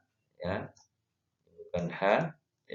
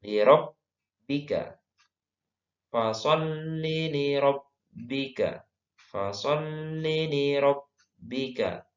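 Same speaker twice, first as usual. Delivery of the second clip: slow, measured Quranic recitation; 6 semitones higher, much slower than usual. Shown as a demonstration. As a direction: recite slowly, stretching the held sounds for learners to follow. Recites the verse slowly. (mengaji tartil, pelan)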